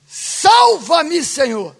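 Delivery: loud plea that falls away at the end; clamor. Acted. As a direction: call out for rescue, then let it drop. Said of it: "É ou não é o clamor". (clamor em voz alta)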